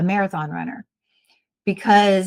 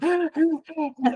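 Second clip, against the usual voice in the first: mimed gasping for air; imitated runner panting; 9 semitones higher than usual. Imitating a marathon runner after the race, gasping. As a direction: pant like a runner just past the finish. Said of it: "They're sitting there going".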